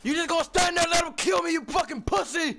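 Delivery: screaming at a teammate; furious yell. Real, not acted. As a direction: yell it, high and enraged. Teammate let him die, yelling.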